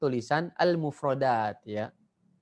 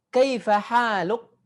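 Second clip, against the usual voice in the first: higher, slow and louder question; model reading; 5 semitones higher, 4 dB louder, slower than usual. Shown as a demonstration. Teacher reading the Arabic question for the class to copy, slow and loud. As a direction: sing it out slowly for repeating. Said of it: "ikuti juga tebalnya dalam bacaan saya".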